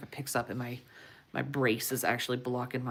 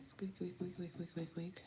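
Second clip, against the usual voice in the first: soft, high whispered squeaks; hushed guinea pig squeaks; squeaky guinea pig; 4 semitones higher, 10 dB quieter than usual. Retold or imitated. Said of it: "Like a guinea pig".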